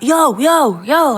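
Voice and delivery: acted street shout; imitated calling out. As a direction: call after someone walking off. Dealer calls after her.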